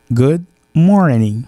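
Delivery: "good morning" drawn out slowly, the "good" open and rounded, each word given its full length; teacher says "good morning" slowly so students hear each sound; slow model pronunciation. Shown as a demonstration. Teacher modelling English for French-speaking students, slow careful pronunciation. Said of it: "Donc, attendez bien".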